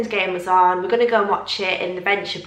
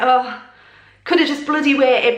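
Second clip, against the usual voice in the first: louder, higher retold huff; annoyed grumble; 4 semitones higher, 5 dB louder than usual. Drinker grumbling at friends who went ahead, annoyed. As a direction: complain with some force, irritated. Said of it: "a bit annoyed with them".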